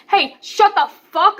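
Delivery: retold yell at the bullies. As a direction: scream it at the top of your lungs. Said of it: "on the top of my lungs".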